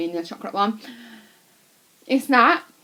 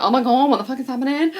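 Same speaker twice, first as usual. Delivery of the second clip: mock panic, louder and higher, rushed; retold freaking out; 5 semitones higher, 6 dB louder, faster than usual. Retelling own nerves before a comedy show, mock freak-out. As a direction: blurt it out fast like someone panicking. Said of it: "freaking out".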